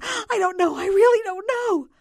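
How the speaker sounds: high-pitched, loud anguished wail; howling. A narrator's reading. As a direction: howl in helpless distress. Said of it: "the voice howled".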